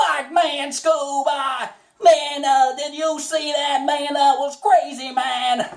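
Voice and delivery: high, drawly Shaggy with a country lilt. Shown as a demonstration. Shaggy marveling to Scooby with a country lilt. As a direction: lean into the country twang, high and loose. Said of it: "the country aspect does help with Shaggy".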